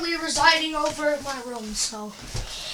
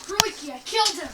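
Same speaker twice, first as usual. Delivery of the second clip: a light Australian lilt; soft, lightly Australian panic; Australian accent; 7 dB quieter, faster than usual. Acted. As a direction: catch yourself slipping into Aussie. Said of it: "Why am I talking like that?".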